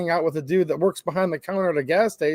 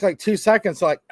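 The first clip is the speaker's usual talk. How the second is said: ordinary delivery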